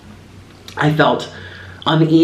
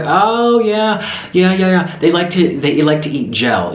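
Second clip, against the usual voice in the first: amused husband; 6 semitones higher than usual. Retold or imitated. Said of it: "he started laughing".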